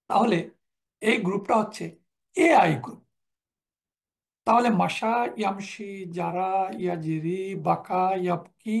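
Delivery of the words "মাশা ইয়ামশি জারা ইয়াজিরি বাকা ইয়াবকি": slow drill. Shown as a demonstration. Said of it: "আর group তিন হচ্ছে".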